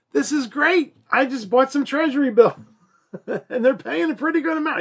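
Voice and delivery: high, bubbly, pleased-with-herself; excited student. Retold or imitated. Student brags to teacher about treasury bills, high and excited. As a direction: gush about a good buy. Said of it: "One of my students last semester said".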